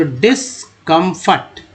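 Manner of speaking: Discomfort slowly, dis-com-fort; syllable by syllable. Shown as a demonstration. Teacher adding DIS to a word, discomfort in slow chunks. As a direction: separate the parts of discomfort. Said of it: "DS को डिस बोलते हैं".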